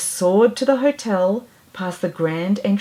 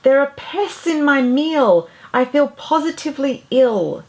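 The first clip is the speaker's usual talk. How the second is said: much higher, louder, shrieking; shrill scream; 6 semitones higher, 4 dB louder than usual. Acted. Diner woman screams about mice in her meal, shrill. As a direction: scream it out in disgust, high. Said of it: "screamed out in a voice that was shrill".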